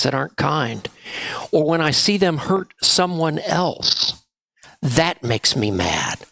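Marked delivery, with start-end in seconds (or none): 4.8-6.2 lower, slow mock-angry voice; a touch of a play-angry grown-up for the kids; mad voice. Shown as a demonstration. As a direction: let it sink low and slow like you're cross. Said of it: "I put on my mad voice".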